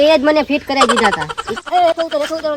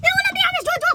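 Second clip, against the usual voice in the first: much higher laughing shout; panicked yelp; 14 semitones higher than usual. Acted. Friend spots the two coming, high laughing shout. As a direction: warn him with a squealing laugh.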